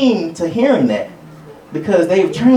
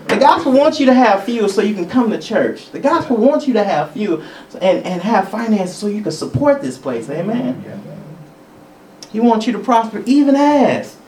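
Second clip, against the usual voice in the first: ordinary delivery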